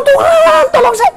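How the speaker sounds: very high, hammed-up cry; pleading for help. Believer begs God for help beside a floating tyre, pleading. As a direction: wail for rescue in a high voice. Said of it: "Saudara minta tolong".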